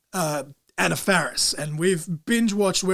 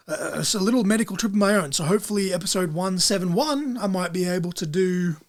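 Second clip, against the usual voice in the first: normal talking voice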